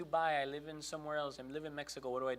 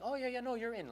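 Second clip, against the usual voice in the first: much higher, breezy agent voice; chirpy phone rep; 12 semitones higher than usual. Retold or imitated. Walmart lady on the phone reassuring him, high and chirpy. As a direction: sing it out like a cheerful call-centre lady.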